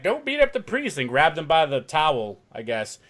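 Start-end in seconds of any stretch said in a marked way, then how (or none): 0.0-1.0 wife. Retold or imitated. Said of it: "his wife was like".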